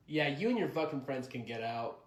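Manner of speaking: ordinary talk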